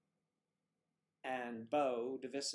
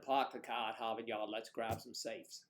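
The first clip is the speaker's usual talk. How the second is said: mock Boston accent; 4 semitones lower than usual.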